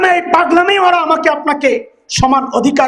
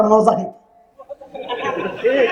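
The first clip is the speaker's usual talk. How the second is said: put-on child's voice; 3 semitones lower, 6 dB quieter, much slower than usual. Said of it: "কয়".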